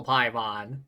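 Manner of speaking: ordinary talk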